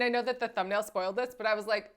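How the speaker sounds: ordinary talk, nothing marked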